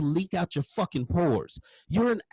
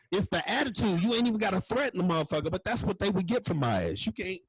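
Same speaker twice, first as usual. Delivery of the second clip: usual speaking voice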